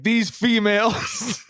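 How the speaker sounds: mock complaint. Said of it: "you're gonna start saying".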